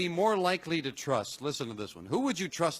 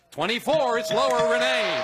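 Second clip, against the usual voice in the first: higher, louder game-show call; excited reveal; 6 semitones higher, 6 dB louder than usual. Real, not acted. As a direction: call out the good news to the contestant. Host announces winning reveal.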